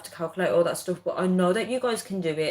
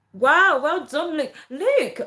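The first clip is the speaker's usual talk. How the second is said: high, loud, drawn-out wow; excited congratulations; 10 semitones higher, 6 dB louder, slower than usual. Real, not acted. Livestreamer congratulating a viewer, excited high cheering. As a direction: cheer them on, pitch swooping way up.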